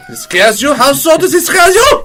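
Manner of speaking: hammed-up German surprise; German accent. Parent asking a scared child, in a mock German accent. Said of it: "German accents".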